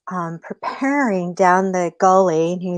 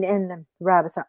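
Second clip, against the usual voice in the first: ordinary delivery, nothing marked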